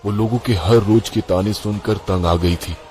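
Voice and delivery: ordinary delivery, nothing marked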